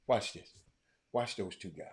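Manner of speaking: usual speaking voice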